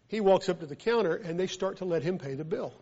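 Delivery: normal talking voice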